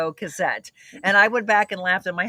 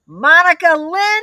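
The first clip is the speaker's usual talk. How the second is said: high, loud, drawn-out full-name holler; mock summoning call; 9 semitones higher, 9 dB louder, slower than usual. Daughter mimics mom finding the peas, calling out. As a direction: imitate a mother calling her child in trouble.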